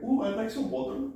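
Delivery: high put-on voice; dad putting on an accent. Dad speaking like the waiter, high. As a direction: slip into a light imitation of the waiter. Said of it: "My dad's like".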